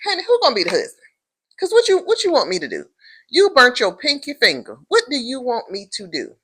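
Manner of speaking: high, loud exasperated rant; fed-up commentator ranting at the wife. Real, not acted. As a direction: throw up your hands, high and loud. Speaker rants at the wife.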